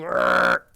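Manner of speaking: low mock-angry growl; a short loud pirate-like roar. Gamer mocking his own yelling, loud low growl. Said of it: "sitting here going".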